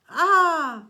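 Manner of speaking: high, loud shriek of pain; pained yell. A narrator's reading. Medusa struck by Grover's branch, loud pained shriek. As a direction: scream as the branch hits. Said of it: "Medusa yelled".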